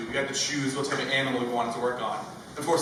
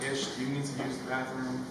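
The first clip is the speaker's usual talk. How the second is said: nice teacher; 8 dB quieter than usual. Retold or imitated. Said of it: "She's a nice lady".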